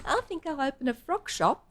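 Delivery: mock flippant. The mother not being casual about her business, mock flippant. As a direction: toss it off lightly as if it were nothing. Dismissing a trivial business idea.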